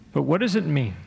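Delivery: usual speaking voice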